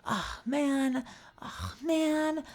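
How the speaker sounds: high, breathy despairing whine. Retold or imitated. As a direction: whine it out, hopeless. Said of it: "being like".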